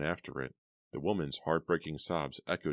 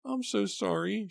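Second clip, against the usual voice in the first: high, louder, breaking into tears; starting to cry; 3 dB louder than usual. A narrator's reading. Mother apologizing on the phone, starting to cry. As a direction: let the tears come. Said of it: "starting to cry".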